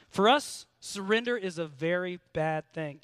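normal talking voice

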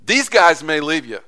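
loud, bold boast in front of everyone; brash declaration. Retold or imitated. Peter boasting loudly before the other disciples. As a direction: proclaim it brashly for the whole group to hear. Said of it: "in pure brash Peter fashion".

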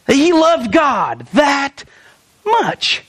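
drawn-out, stretched slow, then a leap up on much; slow emphatic build. Real, not acted. Preacher stretching it out for the church. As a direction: lean hard on how much he loved God. Preacher stressing Peter's devotion.

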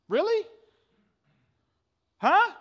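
high, loud mock disbelief; incredulous really. Preacher mocking the entitled listener, incredulous rise. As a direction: pitch it up in exaggerated disbelief.

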